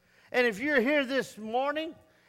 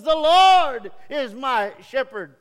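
loud, slow, proud The Lord is my shepherd; proud declaration; 7 dB louder, slower than usual. Retold or imitated. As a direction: say it as a boast. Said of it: "And he says".

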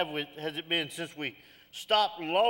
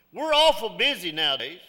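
ordinary talk, nothing marked

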